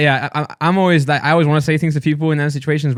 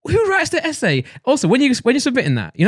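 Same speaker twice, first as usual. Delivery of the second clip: rising, much higher exasperated rant; heated rant; 9 semitones higher than usual. Real, not acted. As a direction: build up the outrage. Ranting at absent lady.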